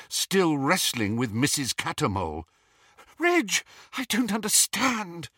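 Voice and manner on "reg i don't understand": high, plaintive female voice; bewildered wife. A narrator's reading. Wife wrestles with supposed husband, bewildered. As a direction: pitch it up in confusion.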